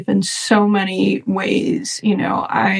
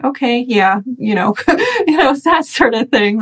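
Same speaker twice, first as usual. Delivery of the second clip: higher, laughing brush-off, mocking her old self; mock dismissive brush-off; 3 semitones higher than usual. Recalling her old dismissive reaction to yoga talk, mock brush-off. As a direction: shrug off present-moment talk with a laugh. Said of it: "I was just like".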